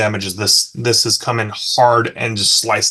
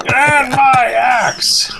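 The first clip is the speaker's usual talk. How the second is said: high, drawn-out battle cry; hammed-up war cry, stretching every word; nonsensical battle yell; 12 semitones higher, much slower than usual. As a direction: yell it high and long, like charging in. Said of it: "hear him kinda nonsensically yell".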